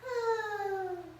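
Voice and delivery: high drawn sigh; heavy-hearted sigh; sad sigh. Acted. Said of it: "the monster sighed".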